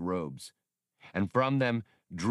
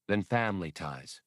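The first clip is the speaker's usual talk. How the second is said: usual speaking voice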